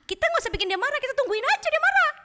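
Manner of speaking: high-pitched devil plotting aloud; high, eager schemer's voice; scheming devil. Acted. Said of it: "dia dalam hati gini".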